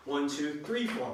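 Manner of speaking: "three" pitched well above the other counts; stress on three. Shown as a demonstration. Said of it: "the emphasis on the three".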